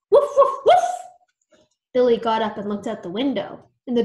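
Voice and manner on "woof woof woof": high, loud yapping barks; dog barking. A narrator's reading. Ghost dog Arf barking at the yard, loud yaps. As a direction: bark like an excited dog.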